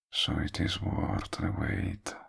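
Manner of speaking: soft whispered aside. Acted. Villain admitting his long wait to listener, whispered. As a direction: let it slip out as a hushed secret.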